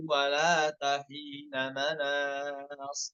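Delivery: "wala tahina" read slowly as a careless idgham; slow chant of "wala tahina manas"; wrong reading. Shown as a demonstration. Teacher showing the wrong idgham slowly. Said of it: "Salah misalkan bacanya".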